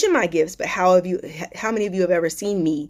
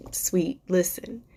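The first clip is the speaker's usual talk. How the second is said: slow whispered hush; 3 dB quieter, much slower than usual. Real, not acted. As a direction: whisper it slowly to calm her. Comforting a hurting viewer.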